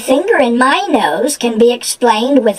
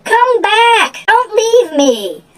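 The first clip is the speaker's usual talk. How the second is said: very high, pleading cry; desperate plea; slower than usual. Acted. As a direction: beg them not to abandon you. Ogress begs as ogres leave.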